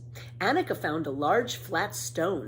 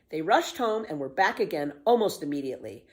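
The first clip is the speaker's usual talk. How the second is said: ordinary delivery, nothing marked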